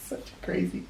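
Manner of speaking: hushed aside; whisper. Real, not acted. Reader marvelling at the picture, whispered. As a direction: breathe it out in awe. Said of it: "reader's aside".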